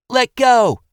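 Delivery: high, loud shrieking yell; woman's yell. A narrator's reading. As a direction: scream at them to let go. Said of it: "the woman yelled".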